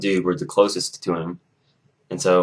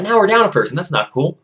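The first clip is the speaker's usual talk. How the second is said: higher-pitched, put-out complaint; indignant protest; 9 semitones higher, 4 dB louder than usual. Retold or imitated. Captain losing a pick to the coach, indignant. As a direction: object to the unfairness.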